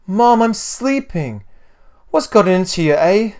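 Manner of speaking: put-on high, loud whining boy; indignant child crying out to mum; boy's cry. A narrator's reading. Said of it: "Luxon cried".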